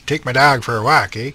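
light East Coast Canadian lilt on "take my dog for a walk, eh?"; a mild East Coast Canadian accent ending in "eh". Shown as a demonstration. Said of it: "that's the East Coast Canadian accent".

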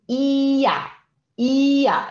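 ia, the J said as a Y glide, slow and clear; German ja as ia. Shown as a demonstration. Teacher demonstrating German ja to students, clearly. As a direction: say ia like yah. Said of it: "Então, vocês falam ia".

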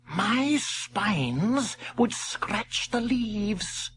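very slow, high porcupine; lazy porcupine excuse. Acted. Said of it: "making an excuse".

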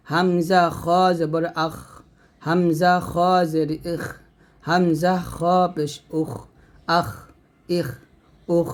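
আখ, ইখ, উখ with a thick, airy kh; heavy Kha. Shown as a demonstration. Teacher shows students a thick kh in আখ, ইখ, উখ, heavy Kha. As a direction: make the kh of আখ, ইখ, উখ full and let air out. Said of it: "খটা মোটা করে উচ্চারণ".

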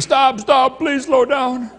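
high, loud mock panicked kids; hammed-up frightened screams of kids on a ride; kids screaming. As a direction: beg them to stop like terrified children. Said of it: "But now they're screaming".

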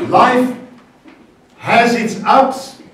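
Life high and loud, has its ups stretched slow; slow saying. Shown as a demonstration. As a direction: lead the congregation slowly. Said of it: "we usually say".

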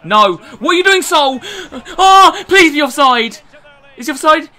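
high, loud alarm; panicked shout. Real, not acted. Gamer pleading for an offside, panicked shout. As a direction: yell it as a desperate plea. Panics over opponent attack.

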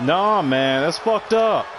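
exasperated protest climbing in pitch; pissed off. Real, not acted. Gamer protesting a messed-up game, pissed off. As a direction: drag out the complaint, pitch jumping up in disbelief. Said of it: "They're pissing me off with this game".

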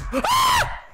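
sudden high-pitched shriek; shrill scream. Real, not acted. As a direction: scream in shock. Sudden attack.

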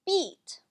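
"beat" with a long ee vowel. Shown as a demonstration. Teacher showing students the long E, long-E pronunciation. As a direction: say "beat" high, with a clear long E. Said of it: "it's pronounced like a long E".

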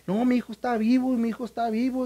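high, pleading insistence; imitating a distraught mother; desperate insistence. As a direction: refuse to believe he's dead. Mother insists son is alive.

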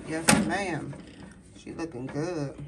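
ordinary talk, nothing marked

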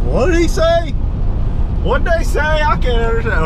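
high-pitched mock bafflement; exaggerated, high confused questioning; baffled viewers. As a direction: play a viewer who can't make out the accent. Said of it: "people probably watching this are gonna be like".

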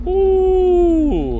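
sudden falsetto squeal of fright; startled high-pitched ooh. Real, not acted. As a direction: yelp up high at the scare. Jumpscare squeal in horror game.

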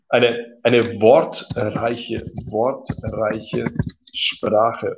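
wortreiche drawn out syllable by syllable; slow word demo. Shown as a demonstration. Teacher modelling a new word for the learner, slowly. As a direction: stretch out wortreiche slowly and clearly. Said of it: "Okay, wortreiche Sprache".

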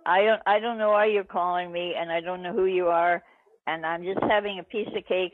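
ordinary delivery

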